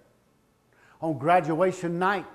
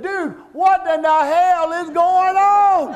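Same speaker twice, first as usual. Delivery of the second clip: loud, high bewildered shout over the crowd; dad shouting to his friend over the noisy crowd; yelling in confusion; 12 semitones higher, 11 dB louder, slower than usual. Retold or imitated. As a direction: holler at a friend across a roaring gym.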